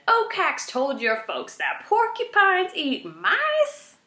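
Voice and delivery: high, slow disbelieving question; incredulous. A narrator's reading. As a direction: spell it out in disbelief. Erith incredulous.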